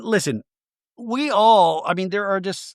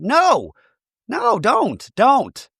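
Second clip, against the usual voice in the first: high, falling cries of protest; higher-pitched exasperated interjection; emphatic objection; 7 semitones higher, much slower than usual. Real, not acted. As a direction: cut in with a raised, pleading no. Interjects against the quoted apology.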